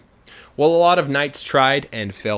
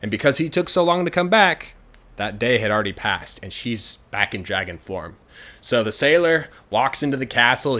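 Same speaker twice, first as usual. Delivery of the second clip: ordinary delivery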